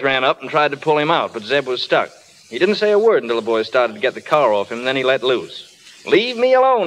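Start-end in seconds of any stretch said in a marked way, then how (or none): 6.1-6.9 hollered. Retold or imitated. Zeb pinned under the car, hollering at the boys to leave him. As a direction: yell it out to be left alone. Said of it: "he hollered".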